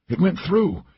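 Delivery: high-pitched character voice; wounded companion. A narrator's reading. Wounded Laura reporting her injury.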